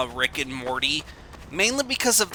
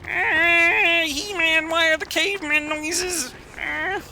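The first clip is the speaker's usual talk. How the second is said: high silly voice; 13 semitones higher, slower than usual. Acted.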